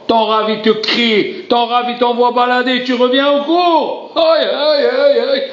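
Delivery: high, slow fervent sermon cry; preacher pleading with the congregation; impassioned preaching. Acted. Preaching at full force.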